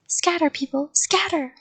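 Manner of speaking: high-pitched, understated yell of warning; yelled alarm. A narrator's reading. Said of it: "I yelled".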